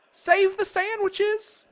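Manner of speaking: high, slow yell across the garden; yelling over the storm, drawn out. A narrator's reading. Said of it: "Gloria Dump yelled to me".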